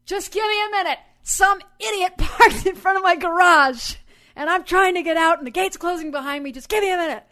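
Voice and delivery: loud, high, exasperated call. Retold or imitated. Calling to the blocked driver, loud exasperated voice. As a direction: yell it across to the driver. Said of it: "I guess I said loudly".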